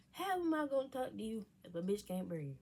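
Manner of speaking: ordinary talk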